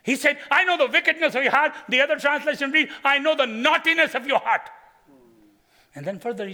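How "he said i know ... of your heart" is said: higher, louder accusing quote; preaching emphasis. Acted. Preacher repeats Eliab's rebuke to the crowd, loudly. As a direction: hammer the brother's words.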